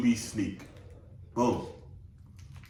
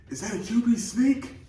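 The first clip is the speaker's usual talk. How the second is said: whisper yelling; 12 semitones higher than usual. Retold or imitated. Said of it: "whisper yelling, just like that".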